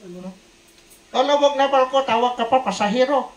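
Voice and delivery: loud, high exasperated scold; angry scolding. Retold or imitated. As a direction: snap at the praying man. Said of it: "nagalit yung Buddhist na inchik".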